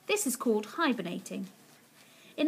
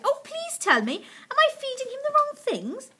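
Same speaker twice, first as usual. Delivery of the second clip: high-pitched eager begging; pleading cry; 14 semitones higher, 4 dB louder than usual. A narrator's reading. Missus Silver begging Mister Hoppy for advice, high pleading. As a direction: plead anxiously, voice right up. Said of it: "she cried".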